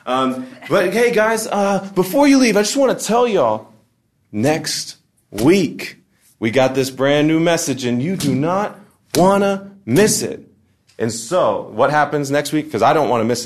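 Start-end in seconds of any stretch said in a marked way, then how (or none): 0.7-10.5 high, loud, over-animated announcement; church emcee. Retold or imitated. Emcee plugging next week's message, hammed-up loud hype. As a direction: hype it like a fake-excited host. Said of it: "M C comes up".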